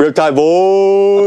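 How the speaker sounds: drawn-out hype call; hyped shout. Acted. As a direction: call it out like a team chant. Hyping their group name.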